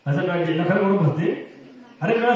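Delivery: usual speaking voice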